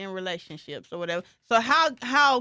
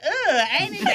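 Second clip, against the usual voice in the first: higher-pitched, exaggerated recoil; mock disgust; 6 semitones higher, 3 dB louder, faster than usual. Mother balks at playing the cuddly aunt, mock disgust. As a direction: retell it with a comic "ugh". Retelling her recoil at the request.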